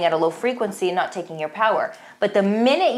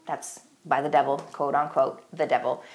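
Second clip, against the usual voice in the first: hushed; 6 semitones lower, 6 dB quieter than usual. Acted. Ominous attribution after quote.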